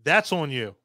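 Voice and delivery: ordinary talk, nothing marked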